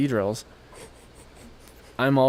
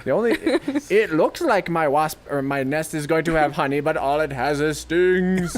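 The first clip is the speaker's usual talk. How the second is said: higher-pitched boastful insect, slowing at the end; mock wasp; 4 semitones higher than usual. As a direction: stretch the last words tauntingly. Voicing the wasp again.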